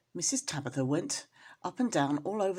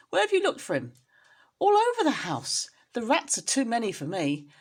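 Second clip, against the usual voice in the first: high, louder fretful cry; worried mother at her wits' end; alarmed high voice; anxious mother cat; 5 semitones higher, 5 dB louder than usual. Acted. Anxious mother cat answering neighbour.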